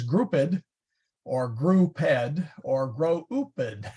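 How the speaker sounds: "grouped" misread as extra syllables, drawn out; varied mispronunciations. Shown as a demonstration. Teacher joking about English spelling, varied mispronunciations. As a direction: stretch "grouped" into wrong, split-up readings. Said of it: "You don't know if it is".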